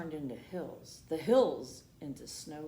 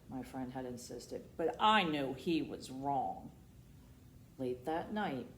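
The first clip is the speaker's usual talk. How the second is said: ordinary delivery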